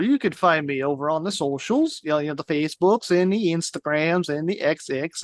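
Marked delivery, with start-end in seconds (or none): 2.0-5.2 a light mock Norwegian accent; lightly put-on Norwegian lilt. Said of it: "why I'm doing Norwegian accent".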